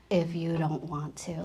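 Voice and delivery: soft husband quote. Retold or imitated. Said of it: "And he said".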